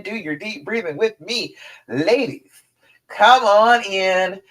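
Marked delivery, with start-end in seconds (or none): 3.1-4.4 slow and a bit louder, stretched out; slow drawn-out invitation. Shown as a demonstration. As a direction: draw out the words warmly and slowly. Repeats invitation to breathe together.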